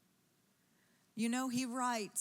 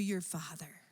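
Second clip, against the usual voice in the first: whisper; 5 semitones lower than usual. Acted. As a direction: whisper the words your father. Sermon aside whispered.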